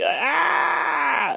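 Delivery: very high, sustained scream; imitated scream. Retelling a man flung in the air, high scream. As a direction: let out a long falsetto shriek. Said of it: "then you just hear this screaming".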